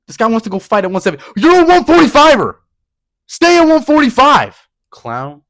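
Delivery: real furious rant; pissed off. Real, not acted. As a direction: blow up in disbelief. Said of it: "I'm getting so pissed".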